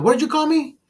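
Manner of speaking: high, indignant mock voice of a touchy customer; offended customer. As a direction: squeak it out, offended and suspicious. Offended customer in retold video.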